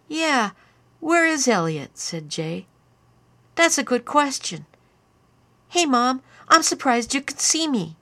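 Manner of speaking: put-on a drunk ten-year-old teasing his mom; tipsy boy taunting. A narrator's reading.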